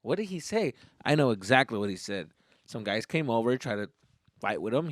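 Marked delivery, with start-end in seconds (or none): 0.0-0.7 hushed bewildered aside; imitating puzzled listeners. Listeners confused by the slang, voiced as a hushed aside. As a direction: drop to a soft, baffled murmur. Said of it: "people right now are like".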